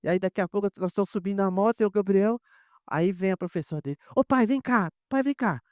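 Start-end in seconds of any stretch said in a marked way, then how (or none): 4.2-5.6 calling out. Retold or imitated. Teacher calling him over.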